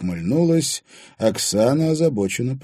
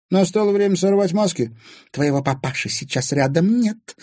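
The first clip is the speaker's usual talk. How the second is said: high, taunting woman's voice; gloating; 10 semitones higher, 4 dB louder than usual. A narrator's reading. Anfisa cornering Timofey without his father, gloating. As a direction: taunt him with raised pitch. Anfisa gleefully unmasks, threatening stepson.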